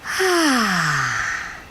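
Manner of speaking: long "ah" sigh. Shown as a demonstration. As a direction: breathe out on a long ah. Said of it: "another one".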